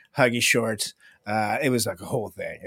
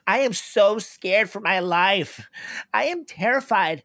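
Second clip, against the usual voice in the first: high, drawn-out fake panic; mock-terrified Karen; 10 semitones higher, slower than usual. Karen on a nine one one call, hammed-up terror. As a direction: overplay the fear, pitched way up. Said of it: "a Karen, sh-, you, there's just a tone".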